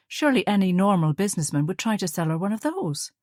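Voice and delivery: plain reading voice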